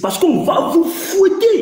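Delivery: high, drawn-out boast to rivals; taunting shout. Real, not acted. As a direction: crow it slowly, pitch leaping up. Taunting opponents in heated debate.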